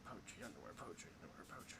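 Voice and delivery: whispering the two options to himself; pondering, hushed; whispered deliberation. Real, not acted. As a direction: mutter the choices under the breath, almost silent. Whispered deliberation over choices.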